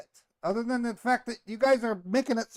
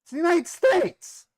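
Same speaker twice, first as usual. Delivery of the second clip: higher and louder, exasperated; exasperated patriotic outburst; indignant outburst; 10 semitones higher, 4 dB louder than usual. Real, not acted. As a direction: throw the words out in protest. Victim argues his innocence.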